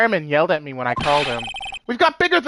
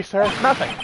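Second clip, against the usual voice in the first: ordinary talk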